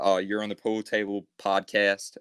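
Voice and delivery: slow, careful attempt at an American accent. Shown as a demonstration. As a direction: put on an American accent, drawn out word by word. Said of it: "in an, an American accent".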